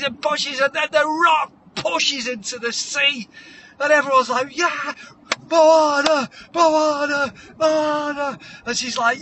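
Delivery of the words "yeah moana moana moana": high, drawn-out chant of the name; mock stadium chant; crowd cheering.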